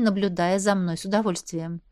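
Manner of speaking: ordinary delivery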